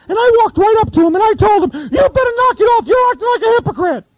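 high squeaky upstart voice. Retold or imitated. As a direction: squeak it out like a cocky newbie. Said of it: "had a squeaky voice like this".

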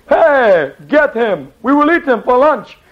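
high, mock-outraged royal command; Assyrian king. Assyrian king ordering the prophet seized, mock outrage. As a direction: order the guards like an affronted king. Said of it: "Now what Assyrian king would ever".

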